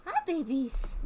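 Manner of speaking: high cooing at the pets. Real, not acted. Cooing at her raccoons through the window. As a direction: gush over them like babies.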